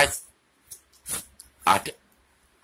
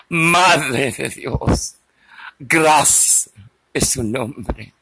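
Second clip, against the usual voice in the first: prayer; 4 semitones higher than usual. Acted. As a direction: recite like a devout rosary. Praying the Hail Mary.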